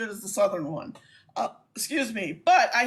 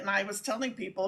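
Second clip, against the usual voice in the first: ordinary talk, nothing marked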